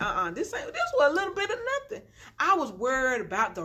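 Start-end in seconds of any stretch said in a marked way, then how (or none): 0.0-2.0 high, fast and breaking into laughter; giggling relief with a crying edge; laughing it off. Retold or imitated. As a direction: laugh it off as a little bit of nothing. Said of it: "you like".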